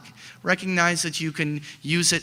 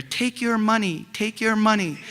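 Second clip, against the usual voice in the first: high, coaxing birdie urging him to cash out, not a true whisper; a tempting little voice pitched high at your ear; 6 semitones higher than usual. Retold or imitated. Birdie on your shoulder tempting the investor: high coaxing voice. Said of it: "this little birdie ... is whispering in your ear".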